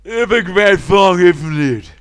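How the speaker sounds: very loud, high, drawn-out put-on voice; bellowed song boast. Singer asks player about the song, high bellow. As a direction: holler it like the awful singer.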